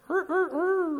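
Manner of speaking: high rooster crow, light and half-hearted. Retold or imitated. Preacher imitates rooster crow.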